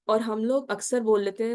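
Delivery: ordinary talk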